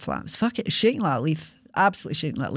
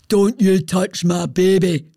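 loud, slow warning in a put-on devil voice; man's voice from inside; 5 dB louder, slower than usual. Shown as a demonstration. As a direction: boom it out like a movie devil. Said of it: "do the voice for you".

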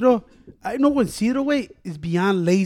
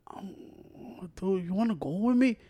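softly imitating a reluctant friend; half-hearted, barely audible asking; mock lazy mood; 11 dB quieter, slower than usual. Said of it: "esa mood que no quiere hacer ni madres".

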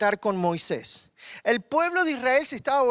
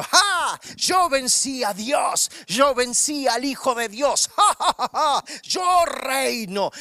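loud gloating devil with a shouted, cackling laugh; 3 semitones higher, 3 dB louder than usual. Acted. The devil gloats over Jesus' sealed tomb, shouting and cackling. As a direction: crow over the victory like a triumphant devil. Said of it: "El diablo contento diciendo".

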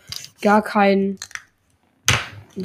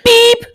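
censor beep; 9 dB louder than usual. Pretend. Self-censoring a swear word.

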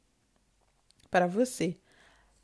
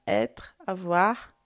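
slow listing; 4 dB louder, slower than usual. Shown as a demonstration. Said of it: "a mesma pastinha".

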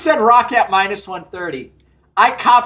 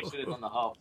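usual speaking voice

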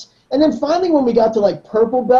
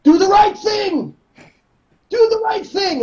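slow, much higher shouted command; Eduardo shouting; 11 semitones higher than usual. Retold or imitated. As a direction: yell it at the class. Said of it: "sayings that he would say".

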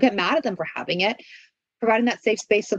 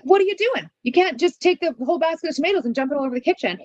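scolding parent; 6 semitones higher, faster than usual. Retold or imitated. Parent scolding little Johnny over spilled tomatoes, high fast scold. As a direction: snap at the kid who spilled tomatoes. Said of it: "you're gonna say something like".

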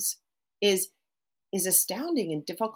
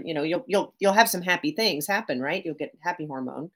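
usual speaking voice

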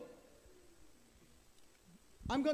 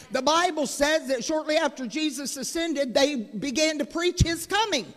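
raised preaching voice, driving forward; 3 semitones higher, 5 dB louder than usual. Acted. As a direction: preach it out to the congregation. Sermon delivered at full force.

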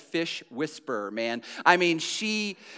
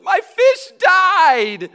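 put-on high, loud, slow wail of a child; drawn-out shriek of a daughter bursting in; 14 semitones higher, 12 dB louder, much slower than usual. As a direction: cry it out like a kid whose fish just died. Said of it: "my daughter Hannah goes".

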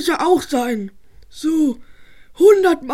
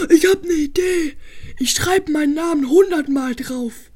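ordinary delivery

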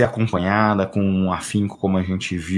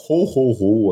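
Santa laugh; slower than usual. Pretend. Christmas wishes, Santa laugh.

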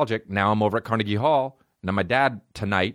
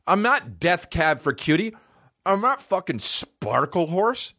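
much higher, slow, outraged mock rant; indignant rant; 9 semitones higher, slower than usual. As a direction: rant like an insulted artist, drawing out the band names. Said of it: "And I'm like".

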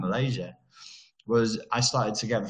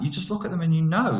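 normal talking voice

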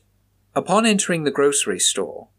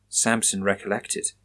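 ordinary delivery

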